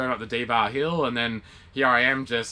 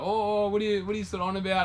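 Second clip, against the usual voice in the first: mock confused thief; 9 semitones higher, faster than usual. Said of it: "And he's kinda like".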